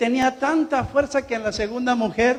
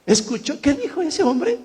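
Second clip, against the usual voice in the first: ordinary talk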